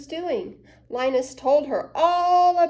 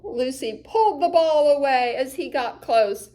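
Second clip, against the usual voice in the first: usual speaking voice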